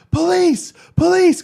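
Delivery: high, loud call for police; yelling for police. Retold or imitated. Sound guy calling the cops, loud high yell. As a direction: shout across the venue for help. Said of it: "he started yelling".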